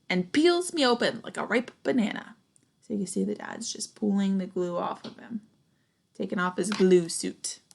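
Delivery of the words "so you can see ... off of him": ordinary delivery, nothing marked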